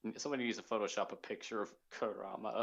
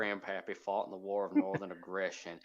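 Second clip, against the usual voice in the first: put-on southern accent; 19 dB louder than usual. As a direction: play a proud Confederate southerner. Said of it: "Anybody needs a southern accent, I can easily do".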